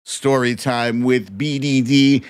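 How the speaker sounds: low TV announcer introducing his own show; announcer intro. Acted. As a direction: drop into a deep radio host intro.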